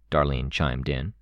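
plain reading voice

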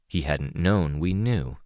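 plain reading voice

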